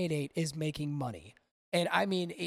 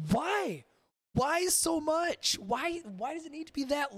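exasperated; 10 semitones higher than usual. Real, not acted. Exasperated at a ten-minute rant.

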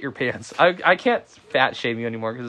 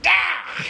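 sleep scream. Retold or imitated. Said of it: "me in my sleep going".

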